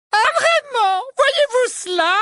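very high, loud mock surprise; a prim witch bristling; indignant Umbridge. A narrator's reading. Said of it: "Elle avait oublié de murmurer". (voix très aiguë et indignée)

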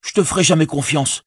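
high, contemptuous dismissal; scornful Sam. Acted. Sam sends Gollum away, high and contemptuous. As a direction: drive the wretch away.

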